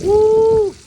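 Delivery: high loud whoop; excited whoop. Real, not acted. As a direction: let out a joyful whoop. Excited cheer after catch.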